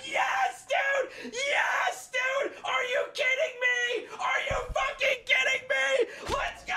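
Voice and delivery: high, screamed celebration, voice squealing upward; shrieking with disbelieving joy; real ecstatic freak-out. Real, not acted. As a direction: scream like you just hit the jackpot. Celebrating a big card pull.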